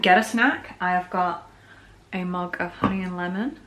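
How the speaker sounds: normal talking voice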